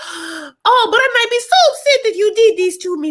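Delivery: very high, loud mock outrage; hammed-up imitation of a hardcore scolding; gasp, then squeal it in mock judgment; judgmental. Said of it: "the way she's saying it is, like, judgmental".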